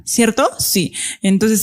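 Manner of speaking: ordinary talk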